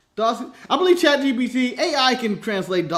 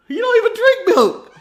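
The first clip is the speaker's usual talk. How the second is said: high, laughing dad retort; 13 semitones higher, 5 dB louder than usual. Acted. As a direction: toss it back as the mock dad. Dad answering kid.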